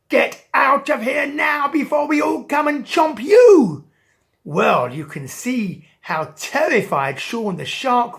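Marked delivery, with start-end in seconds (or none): 0.1-3.8 high, loud angry crowd shout; fish shouting. Acted. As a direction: chase the shark off yelling. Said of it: "started shouting".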